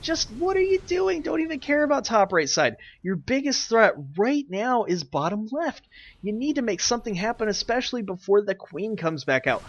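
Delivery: exasperated. Real, not acted. Exasperated yelling at players.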